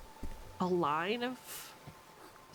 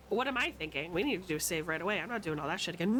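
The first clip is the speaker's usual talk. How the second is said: normal talking voice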